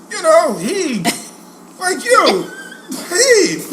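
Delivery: put-on high-pitched kid explaining innocently; blithe young self. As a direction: play a clueless schoolboy, voice lifted high.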